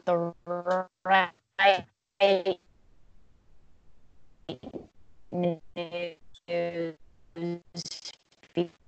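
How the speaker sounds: choppy robotic voice from a glitching call; broken up like a bad video call. Real, not acted. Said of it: "you sounded like a robot".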